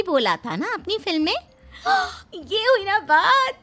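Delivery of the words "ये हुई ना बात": delighted. Acted. Delighted her dialogue is recognized.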